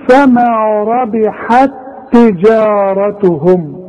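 slow, measured reading running ربحت straight into تجارتهم; joined recitation. Shown as a demonstration. Teacher showing how to read it when joined, slow recitation with one doubled ت. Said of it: "تاء واحدة مشددة".

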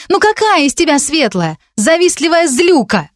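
high-pitched scornful outburst; indignant. A narrator's reading. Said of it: "возмутилась Вика". (возмущённо, с презрением)

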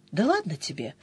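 soft, low, dismissive aside; dismissive brush-off. A narrator's reading. Sister waving off Denis's grumbling, soft and dismissive. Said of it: "отмахнулась я".

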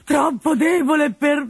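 "troppo debole" slowed and shouted high; exasperated shout. Acted. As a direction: break off the whine into a yell. Builds to shout.

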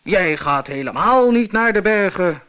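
loud monster roar. Acted. Monster roars at the middle goat, loud. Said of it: "brulde het monster".

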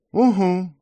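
grumbled. Acted. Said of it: "буркнула Вайпер".

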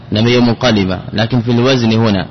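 ordinary talk, nothing marked

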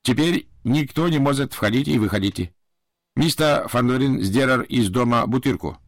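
put-on politely explaining, with a light Japanese accent; a hint of a Japanese servant. Japanese butler explains lockdown. (японский акцент, вежливо)

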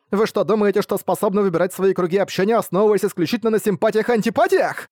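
fierce schoolgirl lecturing class. Acted. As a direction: rail at the class like an outraged class rep. Nadeka berates the class.

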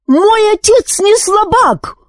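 put-on very high, outraged boy's wail; drawn-out, high-pitched angry cry; furious yell. A narrator's reading. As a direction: protest in a child's high fury. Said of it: "в ярости завопил Зар".

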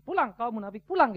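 loud, punched command to leave; dismissive shout. Acted. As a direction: chase them out, punching each command. Acted chasing out of hypocrites.

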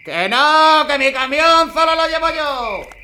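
angry shout. Acted. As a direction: bellow it, no way. Trucker shouts, won't hand over truck.

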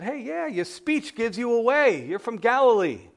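high, rising-to-loud mock accusation; accusing bystander. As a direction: call out the stranger you have recognised. Bystander exposes Peter by accent.

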